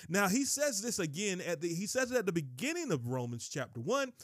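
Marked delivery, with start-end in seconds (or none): none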